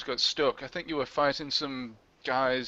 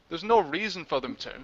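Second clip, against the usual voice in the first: usual speaking voice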